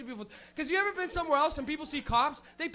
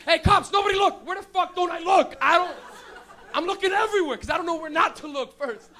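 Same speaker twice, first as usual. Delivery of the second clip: loud, higher, frantic and half laughing; panicked laughing shout; 4 semitones higher, 7 dB louder than usual. Retold or imitated. Stoners in a car spotting cops, panicked laughing shout. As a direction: yell in a panic about where to look.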